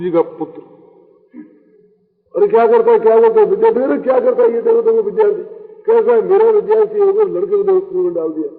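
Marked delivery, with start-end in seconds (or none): 2.4-8.5 louder, higher cry of alarm and reproach; acted outraged scolding. Guru scolding his student over the child in the well, outraged. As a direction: scold in shock, repeating yourself urgently.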